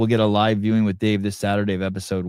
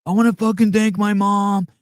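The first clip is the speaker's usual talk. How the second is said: much higher, louder mock fighter; mock fighter interview; 12 semitones higher, 4 dB louder than usual. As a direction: ham up a post-fight thank-you. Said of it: "He's like".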